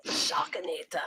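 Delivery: breathy whispered echo; whispering. Real, not acted. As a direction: whisper the name back. Echoes the name in a whisper.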